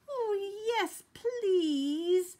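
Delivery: high, eager humming bee voice; Honeybee. A narrator's reading. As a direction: accept gladly in a high bee voice. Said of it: "hummed Honeybee".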